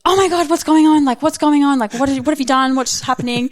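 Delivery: high, loud, panicked yell at her husband; reenacted agitated shout, fading toward the end; frantic yelling. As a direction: burst in yelling over the crying baby. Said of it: "I'm really sorry that I yelled at you".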